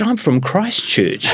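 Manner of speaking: high, slow New Zealand accent imitation; Kiwi accent. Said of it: "in a real Kiwi accent".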